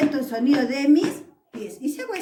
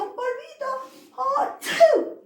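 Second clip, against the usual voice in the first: fake sneeze; 12 semitones higher, much slower than usual. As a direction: play the doll sneezing at dust. Teacher acts doll sneezing. (estornudo exagerado y agudo)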